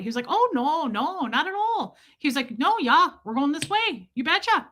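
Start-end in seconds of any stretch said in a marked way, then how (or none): 0.3-2.0 high, slow Midwestern lilt; Midwestern accent. Retold or imitated. Stranger reassures worried traveler, Midwestern accent. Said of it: "Get back to your Midwestern". 2.6-4.7 put-on high, singsong Midwestern accent. Stranger shows the traveler the way, Midwestern accent. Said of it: "Get back to your Midwestern".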